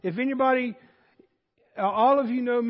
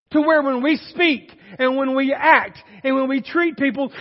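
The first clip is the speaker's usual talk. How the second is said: raised, loud, emphatic sermon delivery; forceful preaching; 3 semitones higher, 5 dB louder than usual. Real, not acted. Preacher urging Christians to represent Christ, forceful preaching. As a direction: drive each phrase home from the pulpit.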